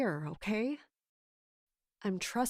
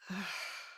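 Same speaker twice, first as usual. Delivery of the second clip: weary sigh; 10 dB quieter than usual. Acted. Weary at steward's arrival.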